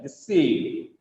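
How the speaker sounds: weight the second syllable of "deceive"; de-CEEEIVE, sliding down; stress on second syllable. Shown as a demonstration. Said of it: "The second syllable is stressed".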